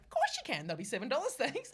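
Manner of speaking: high, singsong customer-service brightness; over-cheery shopkeeper. Retold or imitated. Bookseller rewarding a corrected customer, mock-sweet and high. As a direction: chirp it with exaggerated helpfulness.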